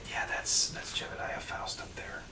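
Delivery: hushed whisper. Acted. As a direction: whisper it secretly. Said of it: "What are you guys whispering about?".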